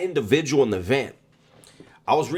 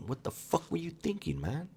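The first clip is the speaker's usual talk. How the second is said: low, hushed rebuke, much softer; said real low; 7 semitones higher, 14 dB quieter than usual. Retold or imitated. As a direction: hiss the rebuke under your breath. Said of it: "I told him real low".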